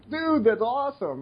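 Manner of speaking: retold enthusiasm. Retold praise for a winner.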